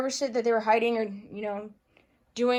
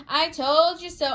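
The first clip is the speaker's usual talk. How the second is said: gloating taunt; 5 semitones higher, 3 dB louder than usual. Retold or imitated. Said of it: "H F I C saying".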